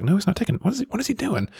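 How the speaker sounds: wondering what the roommate is up to; baffled inner voice. Retold or imitated. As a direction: squeak out the disbelief.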